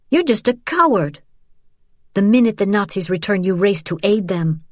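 high, bitter accusation; accusing. Acted. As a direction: call him a coward. Daughter accuses father, high.

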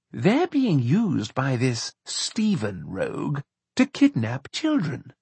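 slow, emphatic character voice; indignant companion. A narrator's reading. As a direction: spell out the outrage word by word. Narrator voices indignant Charles.

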